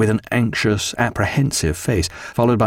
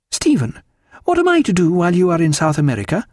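higher, quicker and happier; much happier tone; 7 semitones higher, faster than usual. A narrator's reading. Diana asking husband about her plans, happily. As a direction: brighten up with a lifted, eager pitch. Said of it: "in a very much happier tone".

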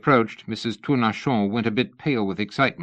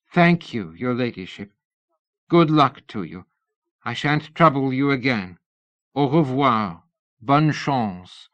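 a higher, gracious Tournachon; bow out with dignity; gracious farewell; 4 semitones higher, 3 dB louder than usual. A narrator's reading. Missus Tournachon saying goodbye to Lady Ada, gracious. Tournachon takes her leave.

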